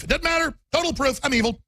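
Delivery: high, sarcastic mock-accusation voice. Host mocks critics who call him a spy, sarcastic and high. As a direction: play the paranoid accuser, dripping sarcasm.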